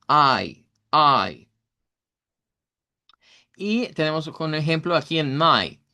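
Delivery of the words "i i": I, I said louder, gliding from ah to ee; diphthong demo. Shown as a demonstration. Teacher drilling the I diphthong for the class. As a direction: repeat I twice, clearly gliding. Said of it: "de la I larga".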